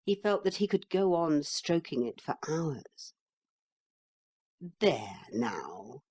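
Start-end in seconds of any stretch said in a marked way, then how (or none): 4.8-6.0 soft and silky. A narrator's reading. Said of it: "in a voice as soft and silky as his coat".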